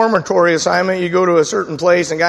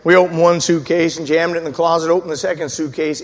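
normal talking voice

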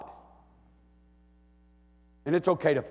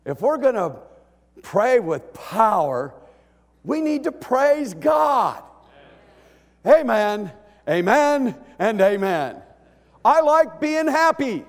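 higher, louder, slow preaching build; full-force preaching; 8 semitones higher, 7 dB louder than usual. Real, not acted. Preacher urging the congregation to praise, full force. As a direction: lift the church with a booming sermon.

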